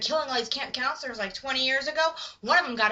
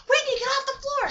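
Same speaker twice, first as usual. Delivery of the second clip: high-pitched acted alarm; urgent warning; 12 semitones higher than usual. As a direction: cry out a warning in mock panic.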